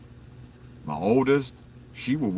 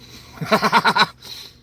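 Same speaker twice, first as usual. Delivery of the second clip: a high, loud laugh that bursts out; burst of laughter; 13 semitones higher, 6 dB louder than usual. Real, not acted. Streamer laughing loudly at a chat comment, high loud laugh.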